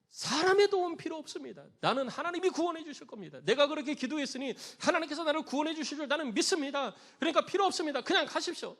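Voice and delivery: high, loud stubborn declaration; acted insistent dismissal; adamant refusal. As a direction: shout up to rescuers to go away. Refusing the helicopter from the water.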